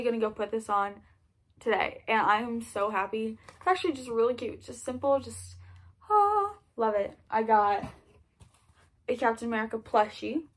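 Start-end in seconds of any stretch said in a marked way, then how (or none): 6.1-7.2 a high, drawn-out delighted "ah"; swooning high sigh of delight; delighted gush. Real, not acted. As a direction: melt over the gift, slowly. Gushing over a Captain America tee.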